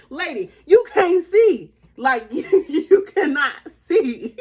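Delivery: slow, laughing mock scold; laughing tease. Daughter teasing her mom, slow laughing scold. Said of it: "And I'm like".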